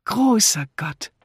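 high, loud, slow exclamation; exclaiming. A narrator's reading. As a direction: cry out in drawn-out astonishment. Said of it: "rief Misses Harmond". (erstaunt ausrufend)

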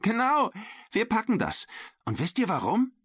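put-on high, excited girl's voice; rally the team in a high, eager voice; cheerful confidence. A narrator's reading. Girl rallying her regatta team, cheerfully excited. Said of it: "Sarah lachte".

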